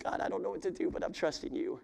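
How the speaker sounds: whispered, worn-out surrender; tired whisper. Acted. Exhausted believer ending a complaint to God, whispered. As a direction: breathe it out like you have nothing left. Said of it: "you're so tired".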